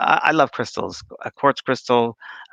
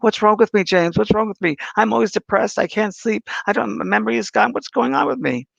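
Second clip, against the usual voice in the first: much higher, mock tearful pleading; imitating a crying client; 9 semitones higher than usual. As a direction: play the distressed lady, pitched way up. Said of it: "she starts crying".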